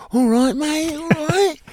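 high, put-on Cockney greeting; chirpy Cockney "All right, mate"; Cockney accent. Shown as a demonstration. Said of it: "learn Cockney".

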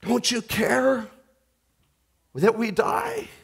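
high, slow, anguished plea; pleading cry. Acted. Disciples begging Jesus to care, slow and high. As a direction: ask it near tears.

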